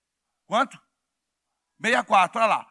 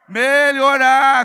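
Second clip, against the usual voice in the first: slow loud shout; 4 semitones higher, 10 dB louder, much slower than usual. Shown as a demonstration. Congregation answer shouted.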